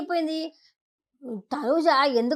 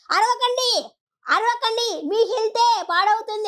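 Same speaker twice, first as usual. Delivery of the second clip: high-pitched mock admonishing; imitated scolding; 6 semitones higher than usual. Retelling Reetu telling a housemate to stop shouting, imitated scolding. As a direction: mimic someone telling another to stop yelling. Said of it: "చెప్పి రీత అంటుండింది".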